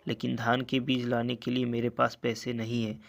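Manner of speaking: ordinary delivery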